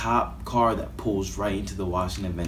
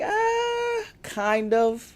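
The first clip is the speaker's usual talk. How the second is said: squeaky high shout then high mumble; goofy high yelp; much slower than usual. Retold or imitated. Teen answering classmates about fleeing cops, squeaky high yelp. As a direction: yelp it way up, sheepish.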